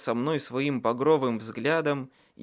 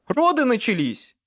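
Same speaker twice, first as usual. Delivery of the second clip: loud, high announcement of emergency; alarmed shout; 9 semitones higher, 7 dB louder than usual. A narrator's reading. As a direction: shout the news across, urgently. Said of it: "крикнул я". (громкий тревожный крик)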